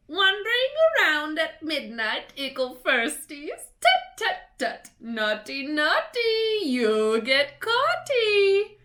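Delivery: high, loud, teasing singsong; mischievous ghost mocking; cackling poltergeist. A narrator's reading. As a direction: taunt the kids with glee. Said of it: "Peeves cackled".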